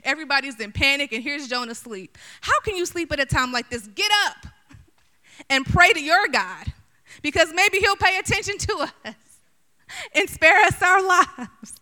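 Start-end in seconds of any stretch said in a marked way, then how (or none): 2.4-11.7 loud, high urgent shout; captain's shout. Acted. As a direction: yell at him to wake up and pray. Captain shouting at sleeping Jonah.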